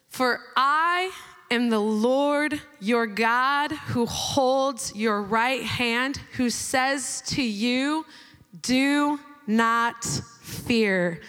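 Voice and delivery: slow, low, weighty reading of God's words; solemn scripture reading. Acted. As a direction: read each word slowly like a proclamation. Reads scripture aloud solemnly.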